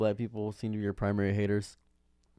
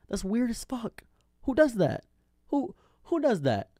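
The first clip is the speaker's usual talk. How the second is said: pitched way up, louder, disbelieving; who on earth does that, voice shooting up; incredulous; 10 semitones higher, 4 dB louder than usual. Real, not acted. Ranting to viewers about dating by race, incredulous high-pitched outburst.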